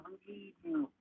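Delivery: lightly voiced pleading friend; urging. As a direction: beg him not to give up in a high soft voice. Urging the despairing boy.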